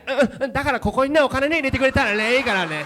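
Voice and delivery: high, loud, very fast pushy pitch; excited rush. Retold or imitated. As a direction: rattle it off, hammed-up and eager. Beggar suddenly speaking Japanese.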